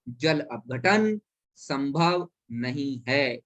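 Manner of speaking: slow, spaced "जल अपघटन संभव नहीं है"; slow repeat. Shown as a demonstration. Teacher repeating slowly for Hindi-medium students. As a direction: lay out each word for the class. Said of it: "एक बार और बोले दे रहा हूं".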